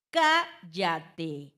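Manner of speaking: "Cállate" silabeado, con CÁ fuerte y larga; énfasis en la sílaba tónica. Shown as a demonstration. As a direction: golpea el CÁ de "Cállate" y separa el resto. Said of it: "antepenúltima". ("Cállate" como ejemplo: CÁ-lla-te)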